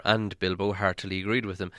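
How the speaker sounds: plain reading voice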